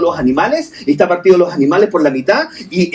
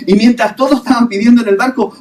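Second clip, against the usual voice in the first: ordinary talk, nothing marked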